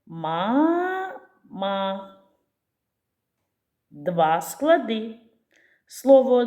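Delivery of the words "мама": "Мама" stretched slowly; syllable by syllable. Shown as a demonstration. Teacher sounding out the word for counting. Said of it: "Скільки складів у цьому слові".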